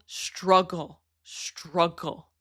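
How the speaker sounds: "struggle", said slowly and repeated after a pause; emphatic repetition. Real, not acted. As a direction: lean hard on "struggle", drawing it out. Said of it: "I can't emphasize that word enough".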